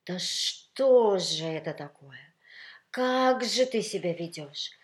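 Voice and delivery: slow, loud, drawn-out lament; high, plaintive scolding; sorrowful reproach. A narrator's reading. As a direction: wail in aggrieved dismay, stretching the words. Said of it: "С печальным вздохом".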